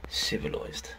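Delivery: breathy whisper, almost no voice; whispered to himself. Real, not acted. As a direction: murmur the word to yourself, admiring. Repeats the word to himself.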